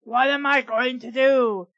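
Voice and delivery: usual speaking voice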